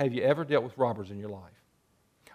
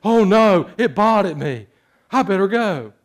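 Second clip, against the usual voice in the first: higher, louder hammed-up fright; mock startled hunter; 6 semitones higher, 9 dB louder than usual. Preacher voicing a hunter scared off by a sheep, mock panic. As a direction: play the spooked hunter for laughs.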